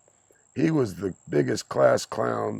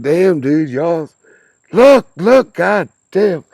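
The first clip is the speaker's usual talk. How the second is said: high, loud laughing yell at friends; excited shouting; 8 semitones higher, 13 dB louder, slower than usual. Retold or imitated. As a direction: holler in disbelief as the ice breaks. Retelling shouting at friends on ice.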